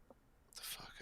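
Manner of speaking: whisper. Real, not acted. Whispered aside.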